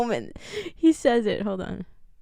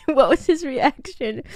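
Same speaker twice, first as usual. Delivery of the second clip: usual speaking voice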